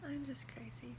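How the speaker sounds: tearful laugh, then a whisper; choked up, laughing it off softly; real tears, soft and shaky laugh. Real, not acted. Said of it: "I'm sorry for crying".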